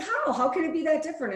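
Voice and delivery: high, fast disbelieving question; incredulous. Retold or imitated. Friend at lunch asking how women differ, in disbelief. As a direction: pitch up in disbelief at her colleague.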